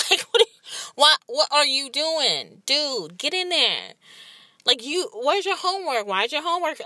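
high, loud scolding of her son; exasperated mom scolding. Retold or imitated. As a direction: snap at the kid like a fed-up mother. Mom scolding son about shower.

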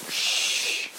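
unvoiced shush; a whispered shh for silence; hushing. A narrator's reading. Miss Reed hushing the room during the broadcast, whispered. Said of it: "said Miss Reed".

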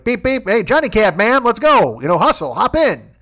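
high, loud cabbie holler; mock honk and shouted summons; calling out. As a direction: hurry a passenger along from the car window. Calling out the car window.

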